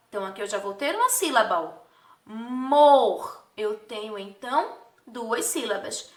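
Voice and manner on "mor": "Mor" loud and held long on its own; careful, drawn-out "Mor", louder than talk; isolated syllable. Shown as a demonstration. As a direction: sound out "Mor" as a separate, stretched syllable. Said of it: "pronunciar a palavra com bastante atenção".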